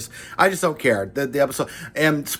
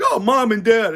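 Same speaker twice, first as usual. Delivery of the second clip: higher, louder cheesy gushing cry; mock sappy reunion; 8 semitones higher, 4 dB louder than usual. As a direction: ham up a syrupy family reunion. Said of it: "It's like".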